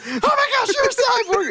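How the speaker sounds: very high, squealing mock freak-out. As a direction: squeal it as a hammed-up impression. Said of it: "She just freaks out".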